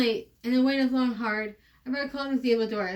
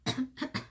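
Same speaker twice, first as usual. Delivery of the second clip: soft unvoiced cough; a weak, breathless little cough; 8 dB quieter than usual. [name] crawls in sick, a soft weak cough. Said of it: "[name] coughs".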